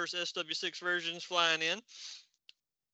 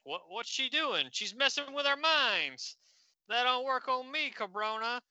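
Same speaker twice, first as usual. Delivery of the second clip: higher, indignant heckler voice; angry heckler; 8 semitones higher than usual. Acted. Rock-thrower defies Saturn Girl, higher indignant heckler voice. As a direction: sneer it up like a street tough.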